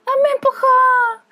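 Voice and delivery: put-on high, slow, whining little girl; whiny crying kid. Aunt imitating crying niece who got pushed, whiny. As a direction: wail it like a toddler tattling. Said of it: "Lore llora de todo".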